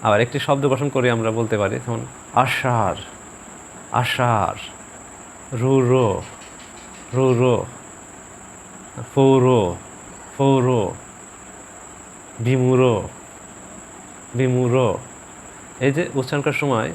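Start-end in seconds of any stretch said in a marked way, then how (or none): 2.4-4.6 আষাঢ় ending in a light h-like breath; careful, separate আষাঢ় with an airy ending; aspirated final R. Shown as a demonstration. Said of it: "হালকা হর উচ্চারণটা আসবে". 5.6-14.8 each word twice, ending রুরো and বিমূঢ় with a light h; deliberate repeated demo, breath after the R in বিমূঢ়; aspirated final R. Shown as a demonstration. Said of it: "হালকা হর উচ্চারণটা আসবে".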